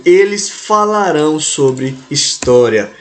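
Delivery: ordinary talk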